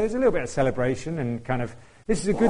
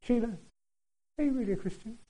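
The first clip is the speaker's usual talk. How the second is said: raised-pitch sly devil voice, quiet; the devil's taunt; 9 semitones higher, 6 dB quieter than usual. Pretend. The devil whispering doubt to a believer, sly. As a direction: coax doubt like a sly tempter. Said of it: "the devil's saying".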